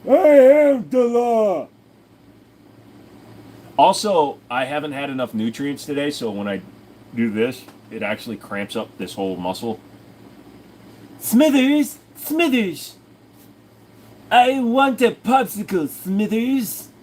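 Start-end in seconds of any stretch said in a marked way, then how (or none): 0.1-1.7 high, slow, loud declaration; slow high shouted decree; movie quote shout. Pretend. 11.2-16.9 high loud whiny calls; high nagging shouts; calling Smithers. Pretend. Mr Burns-style calling.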